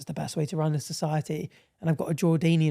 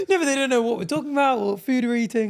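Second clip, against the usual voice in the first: laughing; 6 semitones higher than usual. Real, not acted. Laughing at giving it away.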